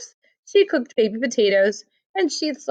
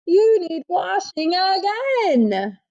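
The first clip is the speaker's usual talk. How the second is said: put-on higher, slow doting baby talk; fond teasing; 8 semitones higher, slower than usual. Amelia telling Missy she needs washing, slow and higher. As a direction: coo it slowly at the baby.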